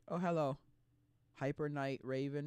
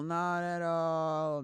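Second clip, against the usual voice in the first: slow repeat; 3 semitones higher, much slower than usual. Acted. Mocking repeated denial.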